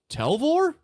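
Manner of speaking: high, loud call of a name. Acted. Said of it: "you hear a voice go".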